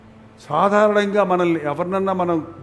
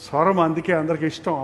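ordinary talk

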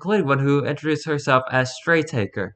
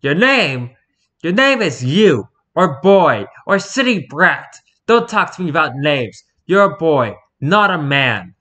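put-on loud, domineering tyrant dressing down a boy; forceful, contemptuous telling-off; angry scolding; 4 semitones higher, 6 dB louder, slower than usual. A narrator's reading. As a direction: put him in his place, loudly. Tyrant angrily scolds the captive boy.

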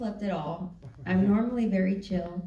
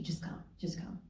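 whispered, much softer; hushed reassurance; 2 semitones lower, 14 dB quieter than usual. Retold or imitated. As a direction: breathe it like a secret promise. Retelling Cindy's reassurance.

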